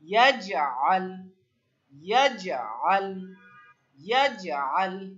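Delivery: slow, loud ইয়াজআল with a bounced জ; deliberate ইয়াজআল with an echo after জ; qalqalah drill. Shown as a demonstration. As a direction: lead a repeat-after-me ইয়াজআল, popping the j. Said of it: "বলেন আমার সাথে".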